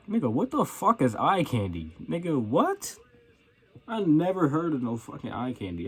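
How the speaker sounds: retold incredulous outburst, pitch swooping up; baffled disbelief. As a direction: act out being totally thrown by a strange phrase. Retold baffled reply to manager.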